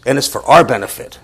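usual speaking voice

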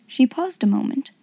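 ordinary delivery

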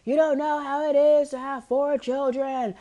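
hammed-up tearful complaint; bawling. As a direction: imitate crying to mom, stretching the words high. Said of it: "I am just bawling, crying".

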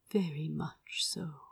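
soft contented whisper. A narrator's reading. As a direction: breathe it out softly. Lovers whispering in bed.

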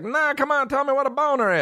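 put-on very high, quick kid pleading; pestering kid imitation. Cousin won't let mom put it off, high insistent mock-kid voice. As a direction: mimic a kid demanding an answer.

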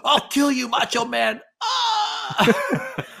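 high slurred yell; town drunk shout. Retold or imitated. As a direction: scream the threat wildly. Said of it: "It's like".

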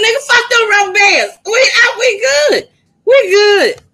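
high, loud happy yell; excited shout. Retold or imitated. To her daddy about the lost rubber bands, high excited shout. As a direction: shout it with joyful relief.

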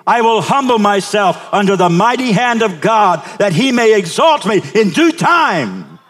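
loud, high, Navy SEAL pledge; loud gung-ho soldier. Shown as a demonstration. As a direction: shout it like a Marine. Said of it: "Say it like a Marine".

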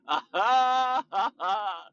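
high, hammed-up imitation of a silly laugh; a high put-on cackle of ha ha ha; mock laugh. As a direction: parody a friend's goofy laugh. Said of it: "He would always make fun of my laugh".

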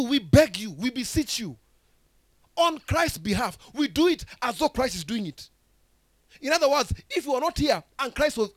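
loud, high, fervent preaching; full-throated pulpit preaching; preaching at full force. Acted. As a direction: preach it out to the whole hall. Preaching to the congregation.

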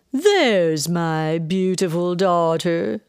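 slow, drawn-out theatrical greeting; gushing mother. A narrator's reading. Mother greeting her daughter with open arms, gushing. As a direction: sweep in grandly, stretching every word.

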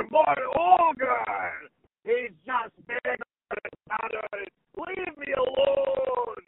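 high, drawn-out Scottish ogre cry; Shrek. Acted. Showing how Shrek differs from the father, Scottish ogre voice. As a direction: bellow it out like a big Scottish ogre. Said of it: "Whereas Shrek, Shrek is, is, for me".

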